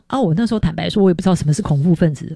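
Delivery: ordinary talk, nothing marked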